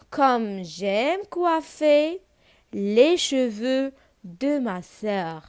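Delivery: very slow, drawn-out model reading; poem recitation. Shown as a demonstration. Reciting poem line for pupils. (قراية الشعر بشوية وبتمطيط)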